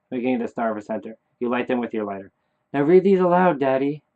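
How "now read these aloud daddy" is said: put-on high, loud child's order; pitched up, insistent instruction; commanding dead son. Dead son orders Daddy to read aloud, high insistent voice.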